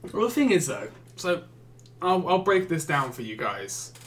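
ordinary delivery

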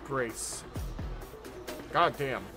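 usual speaking voice

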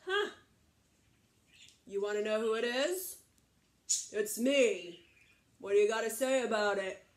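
loud, defiant big brother; tough big goat. Acted. Biggest goat confronting the troll, loud and defiant. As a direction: boast it forcefully, squaring up to him.

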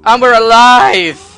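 loud, high, drawn-out victory shout; slow soaring yell of joy; triumphant cry. Real, not acted. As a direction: bellow it like you cheated death.

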